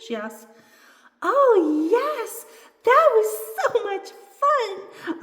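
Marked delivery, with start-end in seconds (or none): 1.2-5.2 high, loud, drawn-out glee; squealing little doll after a thrill ride; delighted. A narrator's reading. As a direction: gush slowly with thrilled delight.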